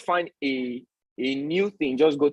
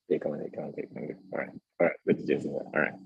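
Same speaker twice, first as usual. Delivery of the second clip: much softer and lower, near-whispered comfort; soothing hush; 12 semitones lower, 8 dB quieter, faster than usual. Real, not acted. Father calming his little son who may cry, soft hushed soothing.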